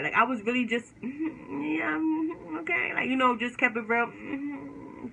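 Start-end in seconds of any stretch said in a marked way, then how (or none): 1.0-2.9 high, sing-song fake mm-hmm; fake agreement. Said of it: "fake, mm-hmm". 4.1-5.1 fake mm-hmm. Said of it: "fake, mm-hmm".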